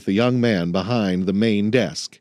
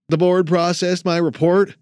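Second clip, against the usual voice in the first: higher, eager trainee voice; young man voice; 9 semitones higher, 3 dB louder than usual. Acted. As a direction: ask it like a nervous recruit. Jason asks, higher voice.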